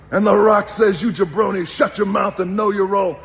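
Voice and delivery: a showman's trash talk, booming at the crowd; wrestling promo voice. Retold or imitated. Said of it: "when I became The Rock".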